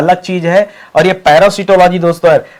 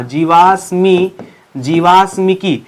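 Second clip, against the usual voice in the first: "जीवाश्मिकी" drawn out part by part; slow, measured syllables on "जीवाश्मिकी"; slow dictation; slower than usual. Shown as a demonstration. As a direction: dictate "जीवाश्मिकी" slowly, stretching the long vowels. Teacher dictates the term.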